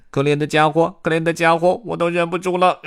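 high character voice giving way to loud laughter; 忍不住笑. A narrator's reading. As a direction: hold it in, then burst out laughing. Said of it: "大家终于忍不住笑翻在草丛中". (高声说着、终于憋不住大笑)

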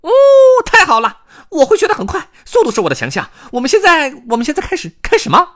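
squealing, very high and loud excitement; 兴奋的野兔. A narrator's reading. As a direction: burst with eagerness as a squeaky hare. 野兔兴奋地答应说书. (尖声兴奋、急不可待的野兔)

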